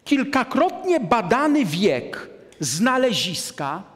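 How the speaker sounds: ordinary delivery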